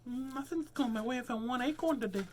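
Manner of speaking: high squeaky critter grumble; high squirrel. Acted. Squirrel voice repeated.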